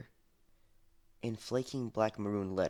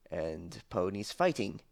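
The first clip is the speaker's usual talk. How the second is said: plain reading voice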